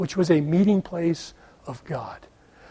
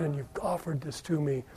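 much softer, awed murmur; hushed prayer; 3 semitones lower, 14 dB quieter than usual. Acted. Worshipper on his face praying in awe, softly. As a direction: pray it face down, barely aloud.

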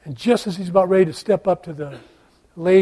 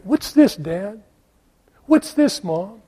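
put-on child asking; 2 semitones higher, slower than usual. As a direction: ask like a curious kid. Kids ask parents.

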